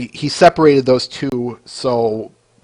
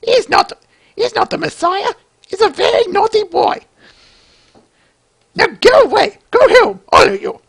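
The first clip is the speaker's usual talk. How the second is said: much higher, shouted scolding falsetto; high shrill mother impression. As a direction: shoo the crowd off in a shrill falsetto. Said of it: "bear with me, Flyboy".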